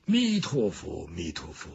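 low monastic muttering; monk's invocation. Acted. Monk intones a Buddhist invocation.

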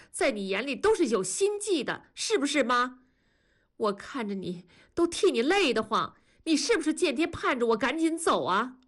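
high-pitched, agitated reproach; aggrieved accusation. Acted. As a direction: pour out grievances at mother. Daughter's grievance in acted quarrel. (音调拔高，委屈地控诉)

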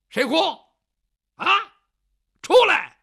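loud high shout; shouted command. Acted. Cleaver in hand, he shouts at the hidden crier to come out. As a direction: yell it at the unseen crier. Man brandishes cleaver at crying.

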